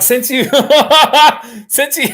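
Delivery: can barely get it out for laughing; breaking up laughing while thanking a donor. Real, not acted. Laughing mid-sentence at donor.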